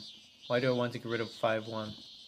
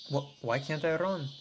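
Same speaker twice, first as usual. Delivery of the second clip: flustered stammer; 5 semitones higher, 4 dB louder than usual. Acted. Junior flustered after losing argument.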